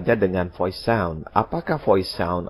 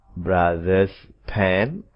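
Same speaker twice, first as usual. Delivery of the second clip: "brother's pen" drawn out word by word; very slow model phrase; slow careful example; much slower than usual. Shown as a demonstration. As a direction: stretch "brother's pen" so the apostrophe stands out. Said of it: "Amati posisinya".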